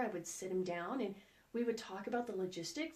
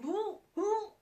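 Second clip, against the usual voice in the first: high, strained "whoa" while gagging; 11 semitones higher, 4 dB louder than usual. Retold or imitated. Mom gagging at her son's poop, high strained cry. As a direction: recoil from the awful smell. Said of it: "So I'm like".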